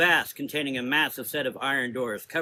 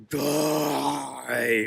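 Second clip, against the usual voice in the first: roared. A narrator's reading. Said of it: "roared the beast even louder".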